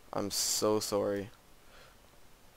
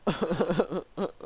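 high disbelieving laugh; 8 semitones higher, 3 dB louder than usual. Real, not acted. Laughing at false hope.

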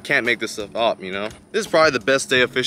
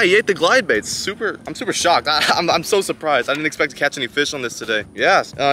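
normal talking voice

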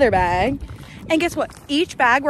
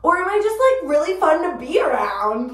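mock self-quote; 6 semitones higher, 4 dB louder than usual. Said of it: "And I'm like".

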